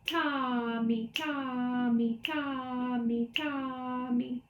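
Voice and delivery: brisker chant, long TA and quick MI. Shown as a demonstration. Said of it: "Again, a little faster".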